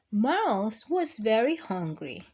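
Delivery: gentle, sing-song picture book reading; lilting read-aloud to kids; reading to children. Acted. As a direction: read it like bedtime story time for little ones.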